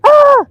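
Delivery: sudden high, loud "ah"; mock scream. Retelling a woman claiming a dog bite, mock scream. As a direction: imitate a stranger's startled yelp, high and loud. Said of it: "And then she goes".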